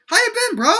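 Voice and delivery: very high, loud bro greeting; excited greeting. A narrator's reading. Brian catches up with William, excited and loud. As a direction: gush at a reunited buddy. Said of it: "he yelled in excitement".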